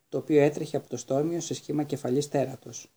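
plain reading voice

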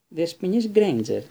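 a stern slow warning; teacher cutting off a student, menacing; threatening. A narrator's reading. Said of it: "με απειλητικό ύφος".

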